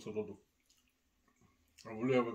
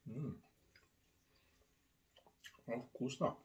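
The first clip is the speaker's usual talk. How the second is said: chewing while speaking; muffled food-filled mumble; mouth full of pizza; 8 dB quieter than usual. Real, not acted. Seen 0: Bit pizza at -5; closed chewing mouth, slice in hand throughout.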